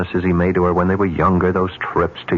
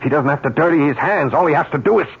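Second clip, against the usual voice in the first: rising agitation; 8 semitones higher than usual. Acted. Arguing with Lou about the call, voice rising to a near shout. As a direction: push harder and higher until you nearly shout call. Heated argument, voice building.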